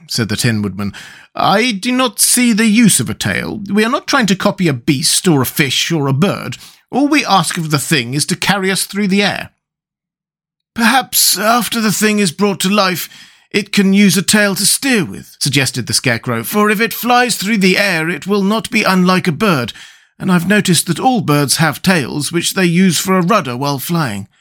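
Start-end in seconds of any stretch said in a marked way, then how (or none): 1.3-9.5 objecting, in a higher pitch; lightly voiced Tin Woodman. A narrator's reading. 10.7-15.3 thoughtful suggestion, pitched up; lightly voiced Scarecrow. A narrator's reading. 16.4-24.3 thoughtful suggestion, pitched up; lightly voiced Scarecrow. A narrator's reading.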